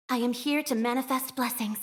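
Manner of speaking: ordinary talk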